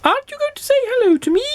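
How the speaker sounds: quick, very high little goblin voice. A narrator's reading. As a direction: ask in a tiny creature's high pipe. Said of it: "asked the goblin".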